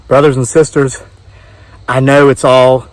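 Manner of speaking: ordinary talk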